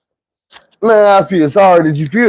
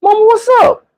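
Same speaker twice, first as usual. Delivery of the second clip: put-on high, sweet innocent child voice; innocent kid voice. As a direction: play dumb for mama. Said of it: "And I'm like".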